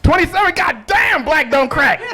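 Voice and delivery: high, loud comic disbelief; astonished shout. Real, not acted. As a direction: shout out your amazement at his age. Astonished at audience member's age.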